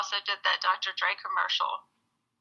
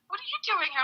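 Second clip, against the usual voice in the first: 1 mom's surprise; 14 semitones higher, 4 dB quieter than usual. Retold or imitated. Surprised mom voiced in story.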